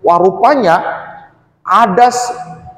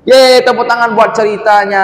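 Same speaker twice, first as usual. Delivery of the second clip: high, loud, happy cheer; cheerful applause call; 7 semitones higher, 5 dB louder than usual. Real, not acted. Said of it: "cheers after the story". (ajakan tepuk tangan yang ceria)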